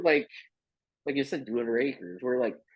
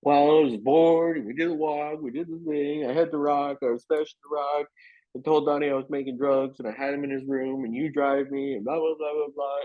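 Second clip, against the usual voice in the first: fast, pitched-up breathless confession; rushed confession; 4 semitones higher than usual. Retold or imitated. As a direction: blurt it all out quickly to mom. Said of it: "confessing to mom in a rush".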